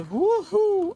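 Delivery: loud, high celebratory woohoo; high whoop. Real, not acted. Gamer escaping a crash in the game, high whoop.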